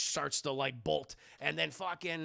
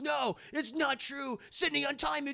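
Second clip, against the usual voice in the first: pleading Jerry; 8 semitones higher than usual. Retold or imitated. Jerry begging Sydney to untie him, higher pleading voice. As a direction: beg her to untie you, mock-desperate. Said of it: "Jerry's like".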